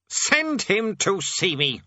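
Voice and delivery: high, slow, weighty order; commanding king. A narrator's reading. Demon King summoning a minor demon, exasperated command. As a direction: order it slowly, word by word.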